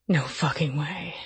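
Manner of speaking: low, soft growl. A narrator's reading. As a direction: growl it like a man. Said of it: "he growled".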